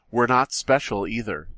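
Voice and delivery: normal talking voice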